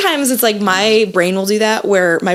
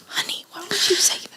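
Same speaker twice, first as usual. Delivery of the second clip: whispered husband; 10 semitones higher, 9 dB quieter than usual. Retold or imitated. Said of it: "he's like".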